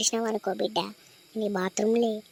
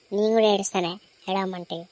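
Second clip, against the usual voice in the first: usual speaking voice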